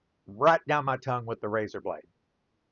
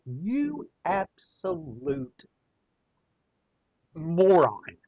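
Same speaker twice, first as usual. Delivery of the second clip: exasperated insult; 5 semitones higher, much slower than usual. Retold or imitated. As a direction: stretch the insult out in disbelief, then land it. Friend spat latex over room.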